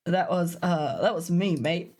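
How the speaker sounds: low, light Cockney robot voice; lightly voiced cheeky Cockney; Cockney accent. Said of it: "I don't wanna do this voice".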